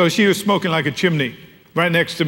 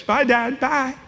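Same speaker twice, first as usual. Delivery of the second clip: higher, sing-song quick goodbye; teenage daughter; 7 semitones higher, slower than usual. Retold or imitated. Teen daughter calls goodbye to dad while rushing down the steps, higher. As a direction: breeze past dad on the way out.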